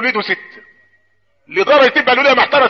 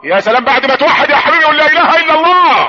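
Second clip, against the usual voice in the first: preacher's call building higher; rousing call to testify; 7 semitones higher, 5 dB louder than usual. Real, not acted. Rouses crowd to declare faith. (نداء حماسي يعلو تدريجيًا)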